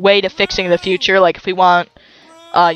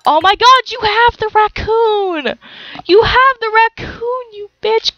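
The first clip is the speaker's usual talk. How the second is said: excited; 12 semitones higher than usual. Real, not acted. Spots the raccoon, squeals.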